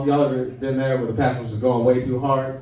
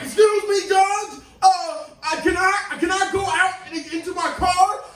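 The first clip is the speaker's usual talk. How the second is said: mock greaser plea; 14 semitones higher than usual. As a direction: plead up high to the judge. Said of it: "he's like".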